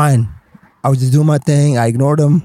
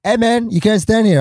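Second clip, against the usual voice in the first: higher-pitched mock confrontation; retold challenge; 7 semitones higher than usual. Costumed character orders a newcomer off his spot, challenging. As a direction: challenge him as a retold turf rival.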